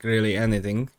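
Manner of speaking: ordinary talk, nothing marked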